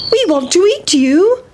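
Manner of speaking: put-on high, eager children's call; high boys calling out; boys' voices. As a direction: chase it with greedy glee. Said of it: "shouted the seven little boys".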